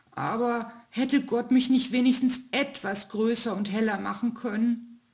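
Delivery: hohe, klagende Kinderstimme; wehmütig rufend, als kleiner Stern; traurige Stimme. Acted. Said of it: "rief der kleine Stern mit trauriger Stimme". (kleiner Stern ruft traurig dem ältesten Stern nach)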